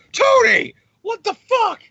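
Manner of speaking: mock outraged shriek; shocked Cap. Cap reacting to drunk Tony.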